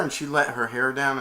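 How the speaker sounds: normal talking voice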